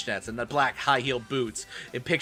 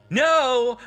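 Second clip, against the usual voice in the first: shout; 12 semitones higher, 6 dB louder than usual. Acted. Running to screaming friend.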